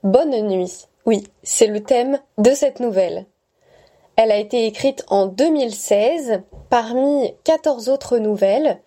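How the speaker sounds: bright host welcome over music; announcer intro. Acted. Host welcoming listeners to a new story, announcer voice. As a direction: greet the listeners like a radio host.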